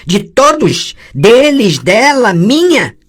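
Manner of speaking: high, loud, angry lashing out; furious outburst. Acted. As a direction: hurl the accusation back.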